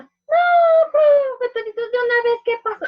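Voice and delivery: put-on a child begging to hear the end now; child pleading with the teacher for the ending.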